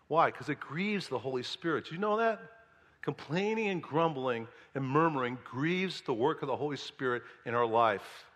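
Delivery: usual speaking voice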